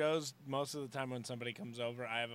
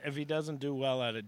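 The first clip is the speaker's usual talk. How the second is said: ordinary delivery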